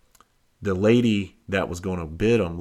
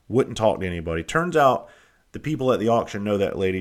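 ordinary talk, nothing marked

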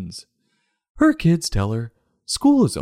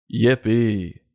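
sarcastic yippee. Acted. Sarcastic cheer.

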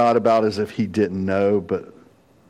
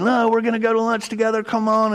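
higher, quick, lightly hammed friend voice; mock buddy insisting; 11 semitones higher, faster than usual. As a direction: rush him along like a pushy pal.

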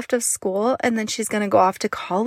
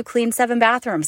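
usual speaking voice

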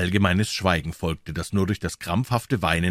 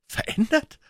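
much higher incredulous cry; shocked cry. A narrator's reading. Paul disbelieves the lawyer, high shocked cry. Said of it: "rief Paul".

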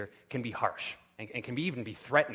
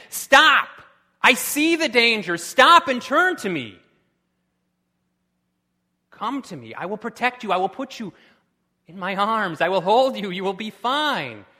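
very loud, high shout that eases into pleading comfort; mother's desperate cry; 8 semitones higher, 13 dB louder than usual. Acted. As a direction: yell stop at the child, then promise safety. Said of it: "crying out, desperate".

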